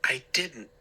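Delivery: halting, tripping over the first word; stammering. A narrator's reading. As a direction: stammer it out nervously. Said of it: "I stammered".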